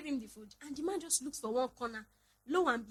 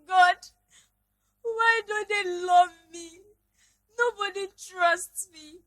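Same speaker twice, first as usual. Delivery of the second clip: imitating crying prayer; 4 semitones higher, 5 dB louder than usual. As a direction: whine like a child who feels nobody trusts her. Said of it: "and I started crying".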